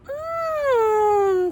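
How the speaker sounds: high sweet mmm at the smell of pudding; girlish delighted mmm; hammed-up yummy hum.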